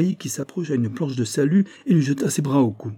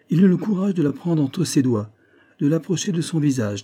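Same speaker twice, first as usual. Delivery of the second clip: higher, insistent emphasis; pressing on words; 6 semitones higher than usual. A narrator's reading. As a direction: bear down on the words to provoke the old man. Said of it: "Maurice appuyait sur les mots".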